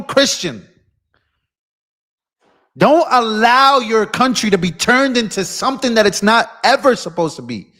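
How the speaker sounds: high, forceful challenge in punched phrases; angry exhortation. Real, not acted. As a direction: demand it of them, drawing out key words.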